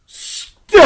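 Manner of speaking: full-throated bellowed "Stella!"; howling a lover's name at full volume; Streetcar yell. Retold or imitated. Streetcar Named Desire reference.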